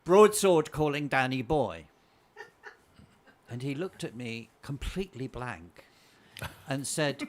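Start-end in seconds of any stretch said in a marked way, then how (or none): 0.0-1.9 loud, slow radio-style call; quoting a film call. Retold or imitated. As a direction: call it out slowly, savouring the famous line. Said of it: "So I said".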